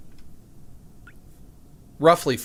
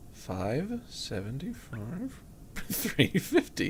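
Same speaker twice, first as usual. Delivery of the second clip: soft, drawn-out words with swooping rises; slow, doubtful repeat; 9 dB quieter, slower than usual. Real, not acted. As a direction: stretch each number out questioningly.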